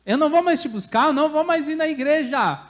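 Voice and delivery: high, loud mock threat to God; childish tantrum. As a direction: protest like a child kicking on the floor. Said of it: "como uma criança se jogando no chão".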